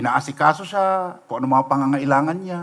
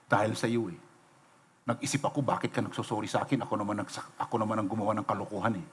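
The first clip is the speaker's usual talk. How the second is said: soft confession; 8 semitones lower, 13 dB quieter, faster than usual. Retold or imitated. Reconciled enemy confessing.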